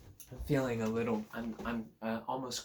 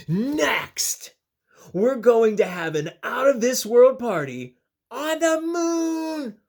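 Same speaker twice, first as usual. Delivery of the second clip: loud, slow, thrilled storyteller creature; excited adventure plan; 10 semitones higher, 13 dB louder, slower than usual. Acted. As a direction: build the wonder of the plan. Narwhal continues plan.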